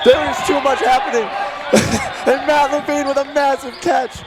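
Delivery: high, loud excited call; excited shouting. Real, not acted. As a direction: yell it like a thrilling play. Wild play-by-play climax.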